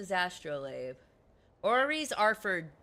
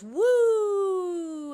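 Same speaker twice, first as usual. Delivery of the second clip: long, high, loud "woo"; imitated whoosh; 12 semitones higher, 8 dB louder than usual. Showing how the planets go, whooping sound. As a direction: send out one sustained, high whooping sound. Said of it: "and it goes".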